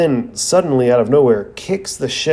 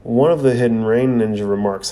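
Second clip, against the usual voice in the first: usual speaking voice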